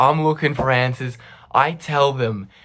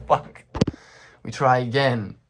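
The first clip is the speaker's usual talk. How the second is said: ordinary talk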